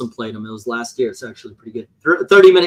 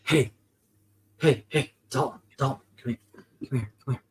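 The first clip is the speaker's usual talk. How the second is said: softer, lower, sly seller's voice; Sesame Street trench coat guy; 4 semitones lower, 9 dB quieter than usual. Retold or imitated. Host imitating the Sesame Street letter seller, softer and lower. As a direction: hiss the offer like a shady salesman. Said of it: "that from Sesame Street".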